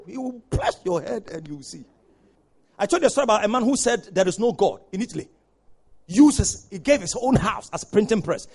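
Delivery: usual speaking voice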